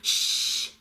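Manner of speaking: sharp unvoiced shush; hissed shush. A narrator's reading. Baby bird Pip hushing his siblings, hissed. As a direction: hush the others quickly. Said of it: "hissed Pip".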